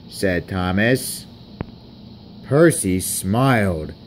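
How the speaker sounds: ordinary delivery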